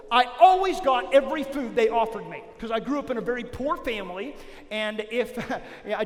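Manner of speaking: usual speaking voice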